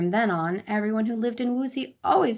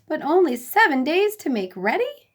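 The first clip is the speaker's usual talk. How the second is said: worried surprise; 6 semitones higher, 4 dB louder than usual. A narrator's reading. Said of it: "said the noble lords".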